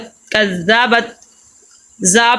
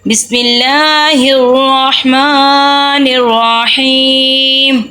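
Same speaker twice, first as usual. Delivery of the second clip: slow Quranic recitation; 3 semitones higher, much slower than usual. Acted. Teacher opening the Qaida lesson with slow recitation. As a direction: recite each word long and even, like tilawat.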